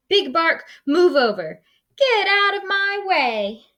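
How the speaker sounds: very high squeaky shout; high defiant critter voice; squeaky defiance. Acted. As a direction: push past the bully in a squeak. Critters charge past bully.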